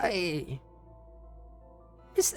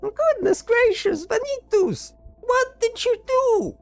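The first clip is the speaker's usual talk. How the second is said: shocked companion; 8 dB louder than usual. Acted. Companion finds Venitus over a body.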